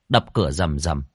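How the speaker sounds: usual speaking voice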